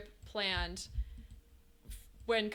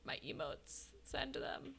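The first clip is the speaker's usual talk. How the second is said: whispered to herself, much softer; 4 semitones higher, 11 dB quieter, slower than usual. Real, not acted. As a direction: breathe the words as a private aside. Reacting to her emotes in chat.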